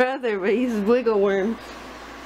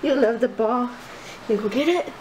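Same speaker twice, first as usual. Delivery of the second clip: ordinary talk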